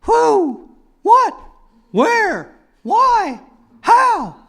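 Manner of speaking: very high hooting questions; owl hooting questions. Pretend. Owl journalist hooting questions, very high. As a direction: hoot each question like an owl. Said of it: "an owl as a journalist".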